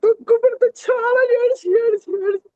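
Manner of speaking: tearful, sobbing through the story; crying while telling. Real, not acted. Retelling her crying at the cross.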